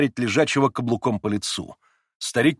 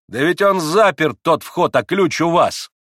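raised, insistent retort; higher, heated protest; exasperated shout; 5 semitones higher, 5 dB louder than usual. A narrator's reading. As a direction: argue loudly against nonsense. Ivan shouts back at father.

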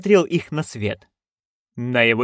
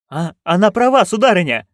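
higher, flustered protest; almost stammering; 7 semitones higher, 4 dB louder than usual. A narrator's reading. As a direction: blurt it out, nearly stammering. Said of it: "едва не заикаясь, пробормотал Том Джон". (растерянно, едва не заикаясь)

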